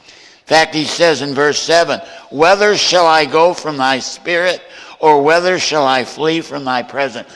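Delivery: loud, ringing pulpit preaching; full-force preaching. Acted. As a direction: preach it out to the whole room. Preaching verses to congregation.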